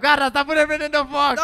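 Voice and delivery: high, loud alert; urgent, high-pitched warning; alarmed exclamation. Real, not acted. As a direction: raise your voice in alarm. Friend's dragon rider catches fire.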